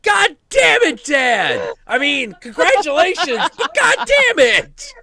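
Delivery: high, loud exasperated shouting. Real, not acted. About dad's new partner, a high loud exasperated shout. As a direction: shout it in mock despair.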